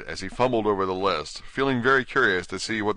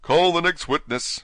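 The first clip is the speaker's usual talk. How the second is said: higher, louder courtroom call; raised voice, summoning the court; King's command; 9 semitones higher, 5 dB louder than usual. Acted. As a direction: call it out like a king presiding. King calling out in court.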